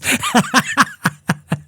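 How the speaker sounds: a high, wheezing burst of laughter. Real, not acted. Retelling the taunt, he bursts into high laughter.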